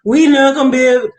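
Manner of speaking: a mocking take on five-year-olds complaining; mocking whiny kids. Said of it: "I'm marking the kids".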